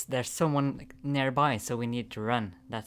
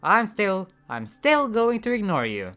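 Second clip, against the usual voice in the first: loud, higher, pressing every word; intense defiant man; 5 semitones higher, 11 dB louder than usual. Acted. Man tells the stranger he won't be driven crazy, loud and intense. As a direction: lay down the law, hammering it home. Voices intense stubborn character.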